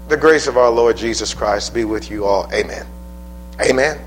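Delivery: usual speaking voice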